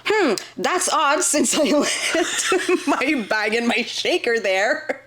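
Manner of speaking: high, slow fake-sweet musing; mock-innocent. Woman retelling gym theft to friend, fake-sweet and slow. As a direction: play dumb about the stolen machine.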